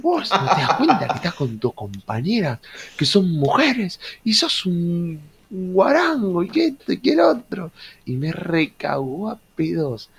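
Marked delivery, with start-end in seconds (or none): 0.0-7.7 mock lecturing teacher, climbing higher; hammed-up sermon rising to a squeal; teacher's sermon building up; scolding teacher. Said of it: "Me decía".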